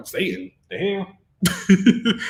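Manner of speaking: slow, drawn-out disbelief; incredulous scoff. Retold or imitated. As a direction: drag it out, unimpressed. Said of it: "So that's why I'm over here like".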